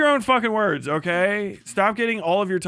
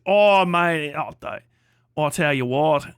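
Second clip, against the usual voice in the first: hammed-up Aussie banter; exaggerated Australian impression; mock Australian accent; 3 semitones lower than usual. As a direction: do a caricature Aussie confiding an insult. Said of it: "goofy shit from wherever the fuck you're from".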